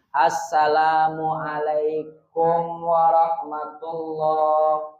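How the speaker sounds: Assalamualaikum warahmatullah drawn out slowly and smoothly; slow perfect salam demo. Shown as a demonstration. Teacher models the perfect prayer salam slowly. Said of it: "Ini yang sempurna".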